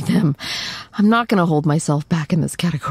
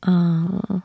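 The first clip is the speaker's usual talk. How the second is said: ordinary delivery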